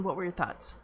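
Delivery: ordinary talk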